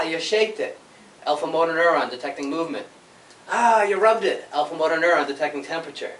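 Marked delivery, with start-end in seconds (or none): 3.5-3.8 higher, louder mock ouch; mock pain cry. Showing students a stubbed finger, mock ouch cry. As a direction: yelp as if you stubbed a finger.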